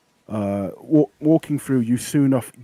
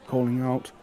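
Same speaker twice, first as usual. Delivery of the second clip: ordinary talk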